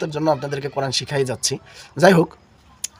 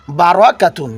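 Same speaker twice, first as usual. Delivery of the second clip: usual speaking voice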